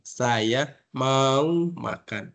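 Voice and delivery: very slow, each word drawn out; slow demo for deaf friends; slow and expressive. Shown as a demonstration. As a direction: mouth "Saya mau makan." slowly with feeling. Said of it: "ditambah dengan ekspresi".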